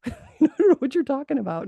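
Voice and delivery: high, loud laughing protest. Retold or imitated. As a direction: laugh through the words. Laughing while retelling her reply.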